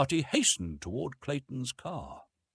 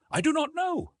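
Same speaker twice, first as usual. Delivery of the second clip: high puzzled reply. A narrator's reading. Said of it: "replied Clayton".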